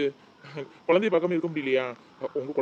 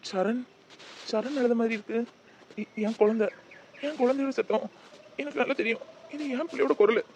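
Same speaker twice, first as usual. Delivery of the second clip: frantic calling; 7 semitones higher than usual. Acted. Father hears his child crying.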